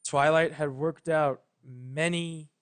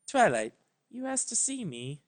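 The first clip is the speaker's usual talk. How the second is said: higher, gentle royal voice; a lilting higher princess voice; melodic Celestia; 6 semitones higher than usual. A narrator's reading. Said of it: "said Celestia melodically".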